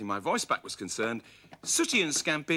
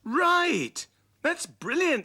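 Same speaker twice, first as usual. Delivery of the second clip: much higher, louder delighted cry; excited Sooty; 4 dB louder, much slower than usual. A narrator's reading. Sooty thrilled with Scampy's find, high and loud. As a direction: burst out with delight. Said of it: "said Sooty".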